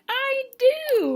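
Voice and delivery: mock gushing. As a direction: imitate a gushing contestant up high. Retold swooning contestant's reply.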